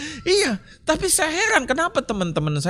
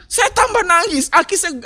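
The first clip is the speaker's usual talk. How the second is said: excited storytelling; 12 semitones higher, 6 dB louder than usual. Real, not acted. Climax of funny crying story.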